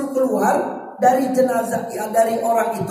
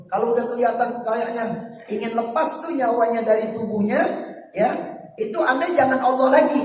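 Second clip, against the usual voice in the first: normal talking voice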